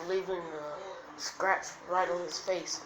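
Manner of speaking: usual speaking voice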